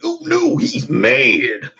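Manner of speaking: high, mock-scared cowboy squeak; imitating the scared-cowboy bit. Reacting to a mad coworker, in a high mock-scared squeak. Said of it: "I just kept doing the voice".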